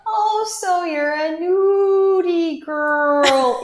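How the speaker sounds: high, very drawn-out grandmotherly croon; slow, high-pitched grandma impersonation; grandma's voice. Retold or imitated. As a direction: imitate grandma, stretching every word high and slow. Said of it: "she has this very specific voice".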